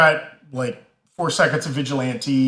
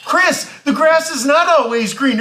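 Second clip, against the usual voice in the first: higher, louder preachy voice; 10 semitones higher, 4 dB louder than usual. Retold or imitated. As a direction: lecture him like a preacher. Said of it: "she's kind of preaching at him".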